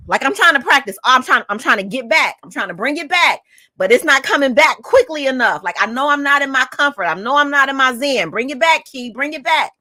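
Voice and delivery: high, loud, frantic self-coaching; worked-up agitation. Retold or imitated. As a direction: reenact fighting to get your composure back. Re-enacts struggling to calm down.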